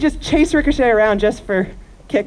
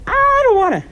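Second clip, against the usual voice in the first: high, stubborn horse refusing; whiny horse voice; 11 semitones higher than usual. Retold or imitated. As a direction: voice the balking horse. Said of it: "Ricochet said".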